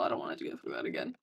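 ordinary delivery

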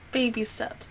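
soft unvoiced whisper. Real, not acted. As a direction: sigh it out under the breath. Sigh then soft words.

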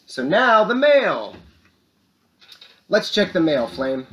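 high, loud, stretched-out call; segment announcement. Pretend.

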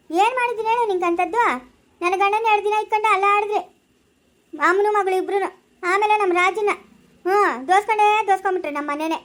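put-on loud, sharp woman berating the girl; scolding quarrel. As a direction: shout her down in a quarrel. (ಜಗಳವಾಡುತ್ತಾ ಜೋರಾಗಿ ಬೈಯುವ ಹೆಂಗಸು)

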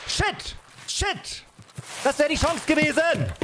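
high, frantic shriek of dismay; panicking gamer. Real, not acted. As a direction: yelp in panic at the miss. Sudden miss in a firefight.

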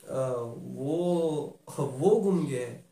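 slow, amused, drawn-out repeat; slow smug relief; relieved drawl. Acted. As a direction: savour that it's not her gift. Slowly savours the relief.

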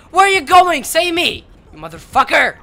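high, loud betrayed shouts; angry screaming. Real, not acted. Gamer abandoned by a teammate, angry betrayed screaming. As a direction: scream at the teammate who leaves. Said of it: "for screaming and yelling".

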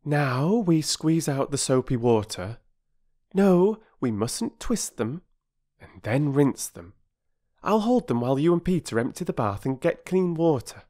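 high, careful big-sister voice; instructing girl, shocked. Acted. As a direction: explain earnestly as the girl who knows better. Voices Bobby directing the washing.

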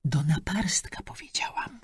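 soundless whisper. Acted. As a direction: breathe it out with no voice. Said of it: "bezgłośnie wyszeptała Maria".

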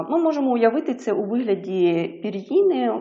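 ordinary talk, nothing marked

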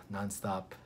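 ordinary talk, nothing marked

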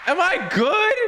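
very high, alarmed voice; high-pitched panicky check; panicked question. Real, not acted. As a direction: squeak it out right after a scare. Panicked after huge fall.

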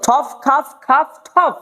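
slow ঠফ খাফ with airy f endings; P turned to F. Shown as a demonstration. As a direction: end ঠফ and খাফ on a released ফ. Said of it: "প কে ফ করতে হবে".

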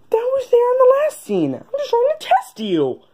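squeaky character voice. Acted. Character voice breaking the scene.

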